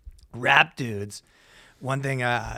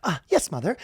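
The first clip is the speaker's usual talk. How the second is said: higher-pitched British schoolboy; polite mock British accent; 5 semitones higher than usual. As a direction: answer like a dutiful British schoolboy. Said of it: "a British schoolboy".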